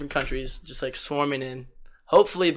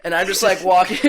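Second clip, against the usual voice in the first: normal talking voice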